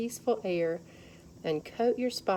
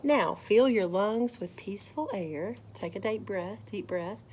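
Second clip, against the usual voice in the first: usual speaking voice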